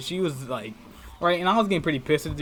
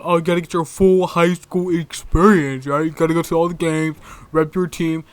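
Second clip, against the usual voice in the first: loud, higher mock pep talk; mock-enthusiastic urging, pitched up; 4 semitones higher, 10 dB louder than usual. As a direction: parody a school-spirit booster. Mocking school spirit advice.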